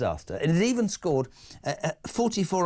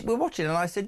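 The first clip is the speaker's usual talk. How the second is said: ordinary talk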